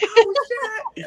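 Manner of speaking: a jolt of panicked surprise; shocked exclamation. Retold or imitated. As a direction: blurt it out in sudden shock. Blacking out on winning.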